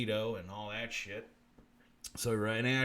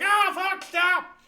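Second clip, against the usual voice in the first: higher, louder yelp in the lisping Southern voice; mock frightened cry; 10 dB louder, much slower than usual. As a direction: squeal it in comic fright. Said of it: "and you're like".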